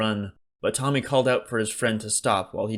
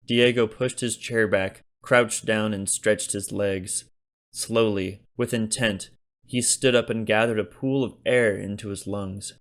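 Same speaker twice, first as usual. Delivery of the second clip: usual speaking voice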